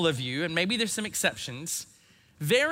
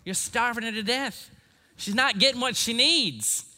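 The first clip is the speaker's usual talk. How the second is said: lightly imitated, higher-pitched Mississippi grandmother; Mississippi accent; 5 semitones higher than usual. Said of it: "in her beautiful Mississippi accent".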